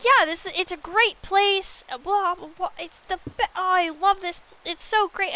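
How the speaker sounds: oversell the place sweetly; gushing tribe mate. Retold or imitated. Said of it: "they're like".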